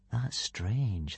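soft, puzzled murmur; inward thought. A narrator's reading. Hiccup studies the ripples, thinking softly. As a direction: voice a private thought very quietly. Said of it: "thought Hiccup".

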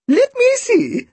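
very high, slow trill; thin, drawn-out high warble; Missus Bodega. A narrator's reading. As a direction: trill it like a birdlike old lady. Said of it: "she trilled".

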